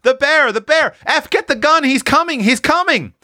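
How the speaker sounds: put-on high panicked child screams, loud; children screaming that a bear is coming; screaming in fright. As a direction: shriek the alarm as terrified kids. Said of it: "all screaming at once".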